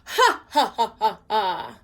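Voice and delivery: loud, taunting ha ha; mocking laugh. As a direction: laugh at her, gloating. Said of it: "that rotten red-headed older brother laugh".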